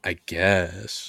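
flat, quiet, bored mumble; sulky teen mumble. Pretend. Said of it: "Sound like an ang-, angsty teenager".

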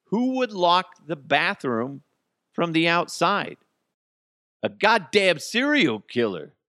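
who would do that, building to a yell; outraged disbelief. Real, not acted. Ranting to listeners about a bathroom locked from outside, in loud disbelief.

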